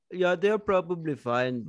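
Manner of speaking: put-on comic Indian accent, brushing off a worry; mock Indian accent. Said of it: "it was an Indian man who moved to Hawaii".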